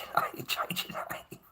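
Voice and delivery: playful whisper through a laugh; half-laughing stage whisper of the advice; mock whisper. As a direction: hush it like a secret warning. Repeating advice, then whispering it.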